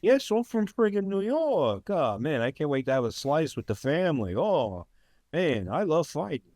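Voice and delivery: lampoon the fighter as a cheesy New York tough; mock New York guy. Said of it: "He's like".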